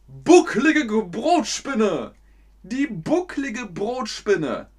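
high, hammed-up cartoon voice; hammed-up insult. Shown as a demonstration. Teacher hams up the top insult in a cartoon voice. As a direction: ham it up like a cartoon villain.

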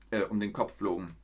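ordinary delivery, nothing marked

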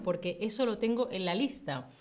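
normal talking voice

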